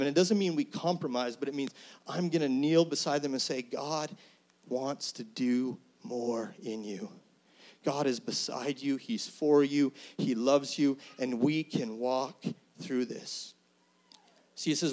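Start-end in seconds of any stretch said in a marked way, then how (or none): 3.6-13.5 slow, soft and tender; gentle reassurance. Pretend. Believer kneeling beside a struggling person, slow and comforting.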